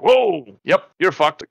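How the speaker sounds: doctor blurting out bad news; mock doctor surprise. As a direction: imitate the doctor's blunt shock. Doctor reacting to injury.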